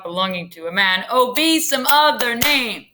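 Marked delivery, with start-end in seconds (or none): 1.1-2.9 Juliet's plea. Shown as a demonstration. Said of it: "if you tap out that, that rhythm".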